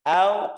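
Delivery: AU short, with little rounding; lax AU. Shown as a demonstration. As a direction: say AU loosely and briefly. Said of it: "Ini beda".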